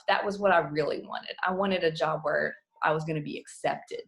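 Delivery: ordinary delivery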